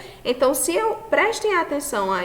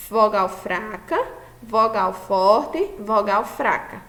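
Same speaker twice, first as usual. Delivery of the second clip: vogal forte said slowly, the word forte stressed; slow and deliberate; slower than usual. Shown as a demonstration. Teacher dictating the tritongo pattern, slow and deliberate. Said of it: "para ser um tritongo".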